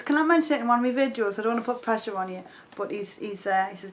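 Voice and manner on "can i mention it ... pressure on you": fast. Retold or imitated. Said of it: "I says".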